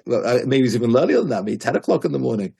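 ordinary delivery

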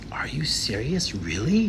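awed near-whisper, slow and hushed; blown away. Retold or imitated. Stranger reacts to hearing of the blessing, hushed amazement. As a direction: breathe it out in hushed disbelief. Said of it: "He was so blown away".

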